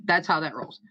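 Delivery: usual speaking voice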